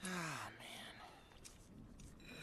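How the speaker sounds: a voiceless, deflated sigh of words; defeated whisper. Acted. Boy alone after losing everything, whispering in defeat. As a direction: let it out in a hopeless whisper.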